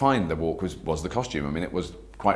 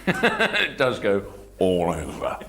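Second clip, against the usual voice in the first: laughing; 3 dB louder, much slower than usual. Real, not acted. Laughing at the rubber mask.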